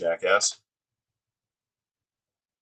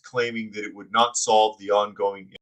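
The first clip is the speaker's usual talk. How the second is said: normal talking voice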